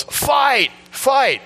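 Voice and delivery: sudden high shout across the bus; high excited shout of Fight; retold yell. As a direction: yell it like a kid egging on a fight. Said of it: "somebody on the bus yells".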